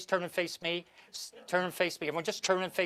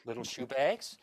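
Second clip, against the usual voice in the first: usual speaking voice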